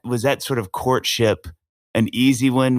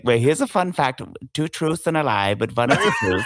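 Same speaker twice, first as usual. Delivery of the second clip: mock German accent at a dinner party; comic German accent, a little higher; imitating German tourist; 4 semitones higher than usual. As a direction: tell it like a German raconteur, hammed up. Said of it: "they're at every dinner party".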